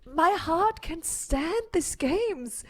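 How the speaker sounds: slow, drained after excitement; breathless. Real, not acted. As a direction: exhale the words, spent. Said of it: "how should my heart survive".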